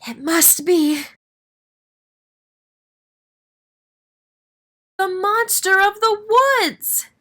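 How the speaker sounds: slow terrified wail. Acted. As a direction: wail it in fright. Friends terrified.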